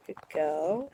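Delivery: usual speaking voice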